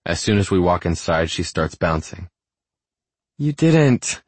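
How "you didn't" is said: high-pitched giddy disbelief. A narrator's reading. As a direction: squeal it lightly, giddy with delight. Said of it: "she says in a giddy tone".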